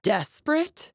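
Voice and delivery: high-pitched incredulous question; offended disbelief. Acted. Champion answers a slight, high and incredulous.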